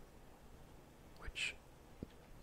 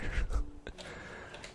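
strong breathy laugh without voice; whispered laugh; 7 dB louder than usual. Real, not acted. Player finding no villagers, whispered laugh.

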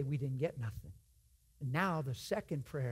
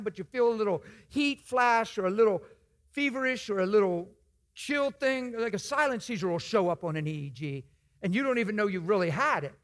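ordinary talk

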